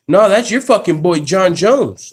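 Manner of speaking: imagined McGregor jabbing at Khabib; imitating McGregor. Imagined McGregor jab about John Jones. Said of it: "I thought he was gonna be like".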